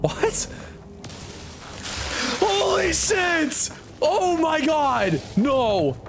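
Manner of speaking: very high, drawn-out cry of shock; high squeal sliding down to a firm no; horrified shriek. Real, not acted. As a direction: recoil in real horror. Startled by creatures from egg.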